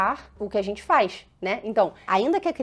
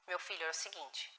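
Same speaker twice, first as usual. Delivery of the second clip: much softer, calm and tender; gentle mother; 5 semitones lower, 17 dB quieter than usual. Shown as a demonstration. Mother opening a talk with her angry child, much softer and tender. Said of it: "E é necessário falar".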